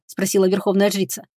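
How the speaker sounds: ordinary delivery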